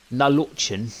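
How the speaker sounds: Nalochan with a mock Scottish lilt, rolled ch; guessing Nalochan in Scottish style; Scottish accent. Shown as a demonstration. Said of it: "said in some Scottish accent".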